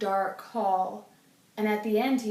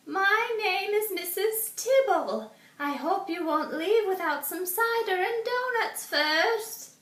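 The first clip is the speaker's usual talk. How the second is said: put-on old woman voice; 10 semitones higher than usual. A narrator's reading. Mrs Tibble welcomes Arthur warmly, high old-lady voice. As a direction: offer it sweetly like a lonely grandmother.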